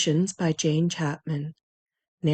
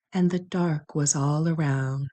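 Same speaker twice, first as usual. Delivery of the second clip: plain reading voice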